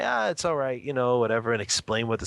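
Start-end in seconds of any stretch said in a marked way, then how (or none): 0.0-0.8 put-on soothing crying baby. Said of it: "I would say".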